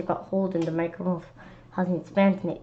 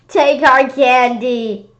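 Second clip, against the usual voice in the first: slow, loud, drawn-out pleas; frantic pleading shout; 4 semitones higher, 8 dB louder, slower than usual. Acted. Kid offering candy to the monster, loud begging. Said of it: "I shouted".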